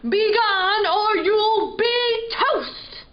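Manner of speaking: a cranky dragon growling at an intruder. A narrator's reading. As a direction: puff out a gruff dragon threat. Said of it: "the dragon puffed and growled".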